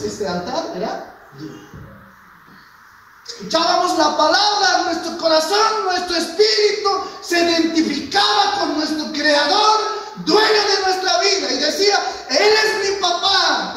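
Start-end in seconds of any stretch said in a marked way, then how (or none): 3.3-13.7 high, loud impassioned sermon shout; full-force preaching. Acted. Preacher recalling first love for God to the congregation, shouted preaching.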